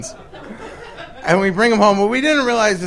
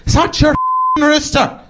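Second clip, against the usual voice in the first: high-pitched irate neighbour impression; mock-furious Irish woman at the door; angry Irish lady; 10 semitones higher than usual. Imitates angry pregnant Irish neighbour.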